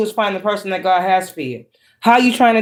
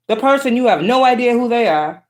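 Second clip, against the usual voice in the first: ordinary talk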